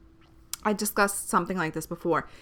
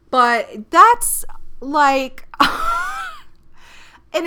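high burst of laughter; laughing; 7 semitones higher, 6 dB louder, much slower than usual. Real, not acted.

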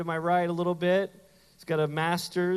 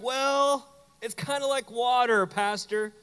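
lightly voiced eager church member, pitched up; eager parishioner; 7 semitones higher, 4 dB louder than usual. Church member offering the pastor a water analogy, eager. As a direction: pipe up higher with a helpful suggestion.

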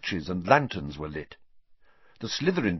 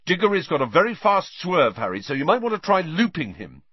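loud, high-pitched calling out; raised, projected shout; shouting after someone; 9 semitones higher, 6 dB louder than usual. A narrator's reading. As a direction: call urgent advice after someone leaving. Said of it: "Wood shouting after him".

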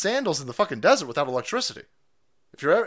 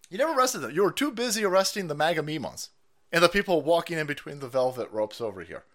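ordinary delivery

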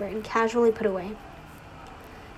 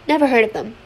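decisive; 8 dB louder than usual. A narrator's reading. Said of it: "he remarked decisively".

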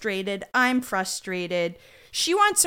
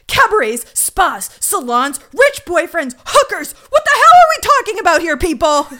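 exasperated shouting rant; 9 semitones higher, 13 dB louder than usual. Retold or imitated. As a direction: list it off louder and louder, then yell the question much higher. Cragen's fuming rant retold.

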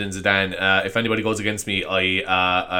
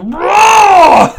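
loud, high hammed-up roar; huge pretend rage bellow; mock monster roar; 15 dB louder than usual. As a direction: roar like a raging green monster. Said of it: "I just turn green and rip things off".

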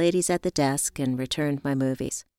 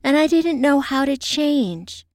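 higher-pitched, earnest friend confiding; unhappy friend; 7 semitones higher than usual. Acted. Friend confiding in Evie how unhappy she was, earnest. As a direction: pour out admiration and regret, voice lifted. Voiced character on a phone call.

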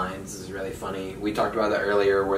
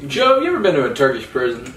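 quoted movie pilot line; 4 semitones higher, 6 dB louder, faster than usual. Retold or imitated. Same pilot quote, rushed.

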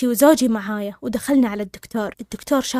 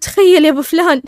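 higher and louder, incredulous announcement; loud, hysterical retelling; 4 semitones higher, 7 dB louder than usual. Retold or imitated. Stunned wife tells her husband the diagnosis, loud. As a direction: raise your voice as if imitating giddy disbelief. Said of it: "أنا صوتي عالي وأضحك".

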